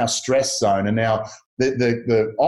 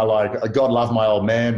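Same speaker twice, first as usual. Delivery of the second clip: usual speaking voice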